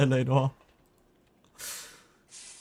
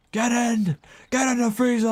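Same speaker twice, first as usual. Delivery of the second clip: shouting; 11 semitones higher, 4 dB louder than usual. Acted. Yelling at character to enter.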